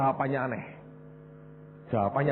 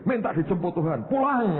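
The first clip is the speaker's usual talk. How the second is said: mock pastor advice; 9 semitones higher than usual.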